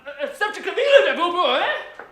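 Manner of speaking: laughing scornfully, loud and high; mocking laugh. Irish priest laughing off the charge of being English. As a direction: jeer at him through laughter.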